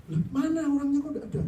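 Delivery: panicked. Retold or imitated. Wife panics, husband missing.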